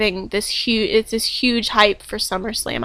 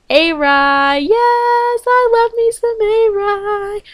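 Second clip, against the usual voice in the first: squealing, much higher, a touch louder and slower; fangirl squeal; 10 semitones higher, 3 dB louder, much slower than usual. Real, not acted. Fan cheering her favourite wrestler's win, squealing.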